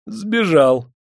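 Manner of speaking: high, plaintive confession; cowed little-man voice; crestfallen. A narrator's reading. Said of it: "поник головой чиновник".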